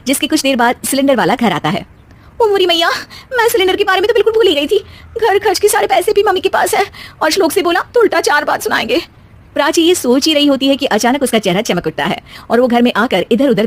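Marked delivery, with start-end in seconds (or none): 2.4-9.0 much higher, fretting; flustered panic. Acted. Daughter-in-law panicking over the forgotten gas cylinder. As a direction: realise the cylinder is forgotten and fret.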